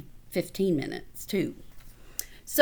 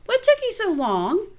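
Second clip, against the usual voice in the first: higher, louder reproach; scolding; 10 semitones higher, 4 dB louder than usual. A narrator's reading. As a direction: scold him sharply. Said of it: "Paul scolded Fred".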